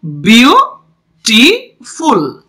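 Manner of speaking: Beautiful split in three, with pauses; broken into syllables. Shown as a demonstration. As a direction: say beautiful slowly as বিউ, টি, ফুল. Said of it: "তিনটে কি রয়েছে?". (Beautiful তিন সিলেবলে ভেঙে, থেমে থেমে)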